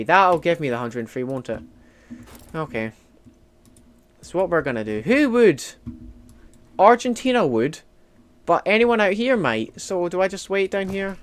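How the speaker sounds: ordinary talk, nothing marked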